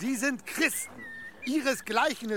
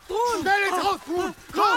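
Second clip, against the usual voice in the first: loud, much higher shouting for his son; urgent shout; 9 semitones higher, 7 dB louder than usual. Acted. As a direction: yell for him to get inside now. Father calls son inside. (lautes, drängendes Rufen nach dem Sohn)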